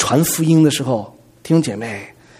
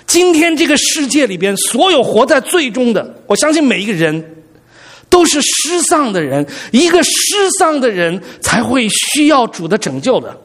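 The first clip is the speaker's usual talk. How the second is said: impassioned preaching; 6 semitones higher than usual. Acted. Preaching at higher pitch.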